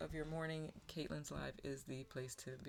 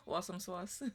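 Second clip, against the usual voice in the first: playful accent on "Awesome sauce", louder and higher; accent played up for a laugh; own regional accent, put on; 4 semitones higher, 5 dB louder than usual. Shown as a demonstration. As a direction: lean into your accent, amused, drawing "sauce" into "swauce". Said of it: "have to say that in my accent for you".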